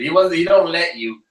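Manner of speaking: ordinary talk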